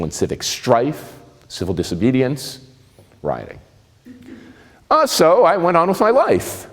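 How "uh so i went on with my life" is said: ordinary talk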